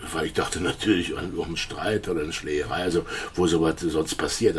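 ordinary talk, nothing marked

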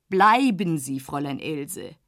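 tiefer, eindringlicher älterer Herr; Dorsday bittet. Acted. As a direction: halte sie mit tiefer Stimme zurück. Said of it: "sagt er". (Dorsday hält Else zurück, tief und eindringlich)

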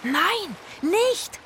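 high alarmed shout. Acted. Friends crying out as Rufus jumps, high alarmed shout. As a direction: cry out to stop him. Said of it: "schrien Itzig und Zora".

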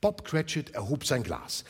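plain reading voice

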